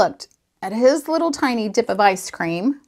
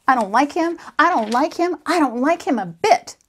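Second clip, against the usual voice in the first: higher, insistent grumbling, building by repetition; acted childish temper, pitched up; fuming; 7 semitones higher, 4 dB louder than usual. A narrator's reading. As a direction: stew over someone you can't stand. Fuming alone about a friend.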